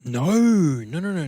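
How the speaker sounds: normal talking voice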